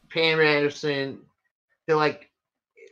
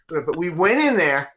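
normal talking voice

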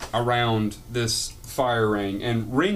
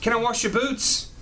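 put-on young boy voice; 10 semitones higher than usual. Young tiefling boy asks Marilyn.

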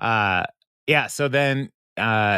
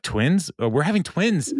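higher-pitched happy astonishment; lightly acted amazement, pitched up; acted surprise; 6 semitones higher than usual. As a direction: imitate parents hearing big news. Acted parents' surprise at scan.

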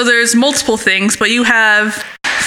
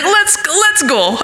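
high, slow mock foreign accent; hammed-up vague Swedish-or-Russian accent; foreign friend accent; 6 semitones higher, slower than usual. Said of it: "This is a foreign friend".

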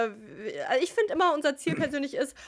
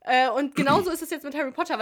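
ordinary talk, nothing marked

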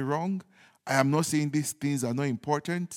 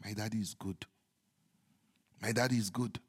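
low, very soft affirmation; calm devotion; 7 semitones lower, 10 dB quieter, faster than usual. Real, not acted. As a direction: say it tenderly to yourself, spaced out. Calm affirmation after worry disappears.

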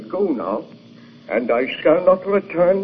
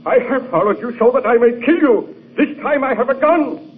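defiant raised voice; 8 semitones higher than usual. Acted. Creator confronts his monster.

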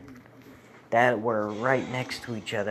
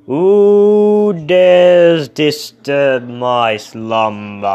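very loud, slow booming monster; louder monster roar; 3 semitones higher, 15 dB louder, much slower than usual. Acted. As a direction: bellow it, shaking the ground. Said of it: "even louder".

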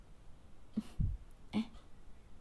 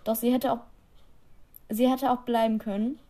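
ordinary delivery

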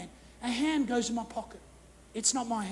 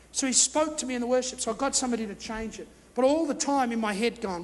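normal talking voice

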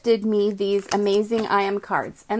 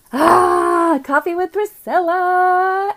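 loud, high, slow announcement of the show name; excited show announcement; show intro; 8 semitones higher, 7 dB louder, much slower than usual. Real, not acted. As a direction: proclaim the title. Announcing her show title.

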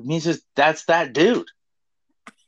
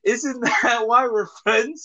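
ordinary talk, nothing marked

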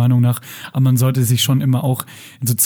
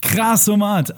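excited exclamation; 8 semitones higher, much slower than usual. Real, not acted. Enthusiastic slang exclamation.

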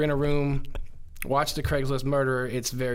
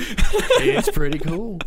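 high, loud voice broken by laughter; laughing mock voice; 9 semitones higher, 8 dB louder than usual. Mocking the amazed roommates, laughing. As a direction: lampoon the roommates through laughter.

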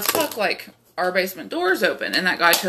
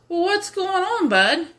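dopey high voice; 10 semitones higher than usual. Retold or imitated. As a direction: play it stoned and clueless, pitched up. Stoned her answering the stranger.

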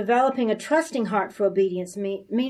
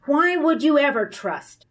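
normal talking voice

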